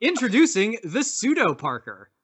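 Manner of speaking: cheesy late-night ad read; infomercial pitch. Acted. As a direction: sell it like a TV ad.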